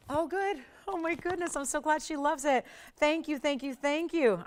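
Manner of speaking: 声を高く上げて、ほっとした喜びで; excited, high-pitched thanks, full of relief; relieved delight. Real, not acted. Host relieved at buyer's name.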